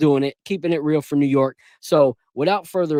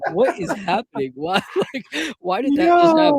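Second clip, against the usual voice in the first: laughing disbelief; 10 semitones higher than usual. Retold or imitated. Laughing at own random thought.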